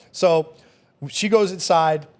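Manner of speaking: ordinary talk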